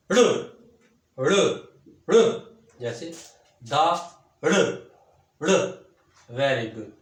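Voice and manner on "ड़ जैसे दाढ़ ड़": ड़ and दाढ़ said loud and slowly; heavy sound. Shown as a demonstration. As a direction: press the ड़ heavily. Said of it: "original जो ख़ास आवाज़ है". (भारी आवाज़ में ड़)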